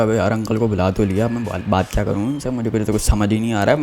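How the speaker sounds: muttered softly to himself, lost; voicing a panicked thought under his breath; quiet inner thought. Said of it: "मैंने कहा". (धीमे से मन में सोचते हुए)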